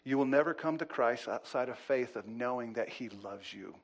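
ordinary talk, nothing marked

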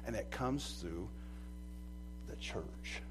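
hushed, unvoiced intimate words; whisper. Preacher whispering God's love to his church. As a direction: whisper it like a secret.

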